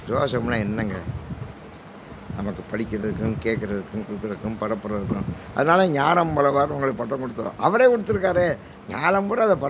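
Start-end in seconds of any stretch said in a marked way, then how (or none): none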